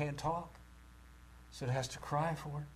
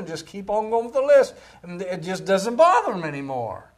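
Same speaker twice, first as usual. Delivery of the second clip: loud preaching, climbing higher as the list builds; full-force preaching; 6 semitones higher, 13 dB louder than usual. Real, not acted. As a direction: drive the list home from the pulpit.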